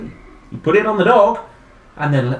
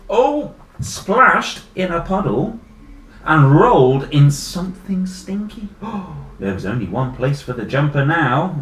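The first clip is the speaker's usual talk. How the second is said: story narration; 2 semitones higher, 8 dB louder than usual. A narrator's reading. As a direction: relish each mishap in the tale. Reads story aloud to children.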